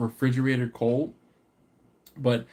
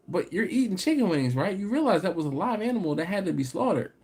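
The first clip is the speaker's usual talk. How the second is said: heated rant; 8 semitones higher than usual. Real, not acted. Ranting at meat eaters.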